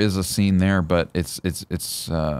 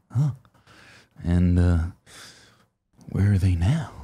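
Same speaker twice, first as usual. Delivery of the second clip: higher, far-off eerie film voice; Shining character voice; 3 semitones higher than usual. Retold or imitated. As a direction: play the unsettling hotel figure. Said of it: "The Shining is my favorite film".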